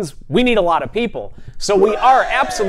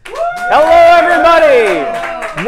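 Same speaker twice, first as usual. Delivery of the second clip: big drawn-out show opener, higher and louder; announcer greeting; 9 semitones higher, 7 dB louder, slower than usual. Acted. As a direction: welcome the crowd like a show host. Host opens live show.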